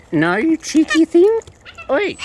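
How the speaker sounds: loud high playful scold. Real, not acted. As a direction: tell the bird off playfully. Scolding bird.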